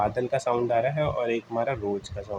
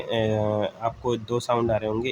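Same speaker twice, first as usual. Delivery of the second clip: ordinary talk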